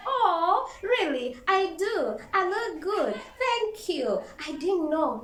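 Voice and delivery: loud, high, giggly fake surprise; hammed-up surprised gushing at a compliment; mock flattered surprise. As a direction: gush as if the compliment is news. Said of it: "and you're like".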